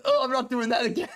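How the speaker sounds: quick, lightly voiced mimic of the drunk lad; quoting the lad. Streamer quoting the lad from the clip, a light quick mimic. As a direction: toss off his line in a slight put-on voice. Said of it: "The part where he says".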